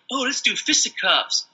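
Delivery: high-pitched, prissy parody; mocking put-on voice. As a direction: ridicule polite fighters in a silly voice. Mocks genteel way of fighting.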